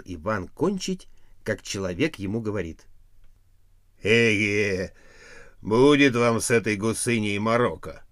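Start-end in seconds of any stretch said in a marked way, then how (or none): 4.0-8.0 man with the hen. A narrator's reading.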